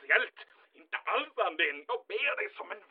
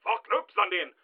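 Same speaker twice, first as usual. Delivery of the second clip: ordinary delivery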